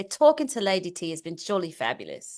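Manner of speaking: put-on soft British English accent; quietly polished English accent, posh attempt. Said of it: "in your best accent or English accent".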